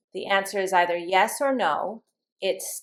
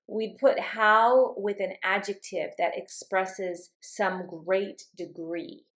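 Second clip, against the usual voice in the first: ordinary delivery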